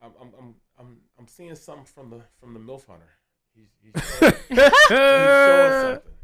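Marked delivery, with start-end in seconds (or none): 3.5-6.1 high, loud hype; excited. Real, not acted. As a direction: shout it like a fan on his feet. Hyping player excitedly.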